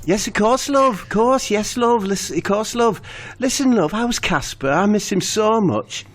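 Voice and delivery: high, pleading Steve; voicing Steve.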